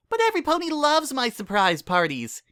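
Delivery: bouncy indignant squeal; high excited filly voice; Pinkie, indignant. A narrator's reading.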